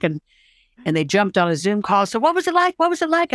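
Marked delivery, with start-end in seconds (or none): 2.1-3.3 high-pitched, excited questioning; eager friends imitated. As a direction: imitate friends pestering for news, much higher. Friends acted, eagerly asking on Zoom.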